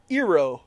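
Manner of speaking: "Iroh" said as EE-roh; mispronounced name. Shown as a demonstration. Host mocking the film's "Iroh" as EE-roh. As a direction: start "Iroh" with a long "ee". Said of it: "mispronounce basically everybody important's name".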